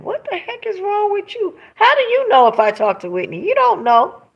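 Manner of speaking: high, exasperated mock challenge; indignant retort. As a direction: fire it back at the accuser, outraged. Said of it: "And I'm going".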